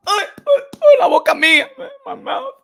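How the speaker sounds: high crying wail in Spanish; hammed-up tearful ay ay ay; mock lament. Scolding himself for swearing, mock crying.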